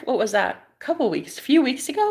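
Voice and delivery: usual speaking voice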